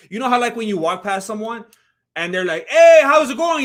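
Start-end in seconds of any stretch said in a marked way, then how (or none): 2.7-3.6 loud, high mock-cheery hello; hearty greeting. Someone greeting you as you walk past, hearty greeting. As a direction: boom out an exaggerated friendly greeting. Passerby greeting voiced in a story.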